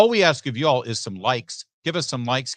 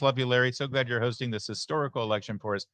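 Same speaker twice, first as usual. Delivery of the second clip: usual speaking voice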